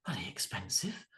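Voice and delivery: a hint of a tight-fisted dad complaining about the price; grumbling dad. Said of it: "the dads looking around going".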